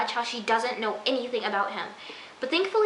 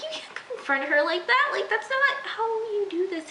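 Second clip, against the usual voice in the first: mock scolding; 10 semitones higher than usual. Scolding Hannah as if present.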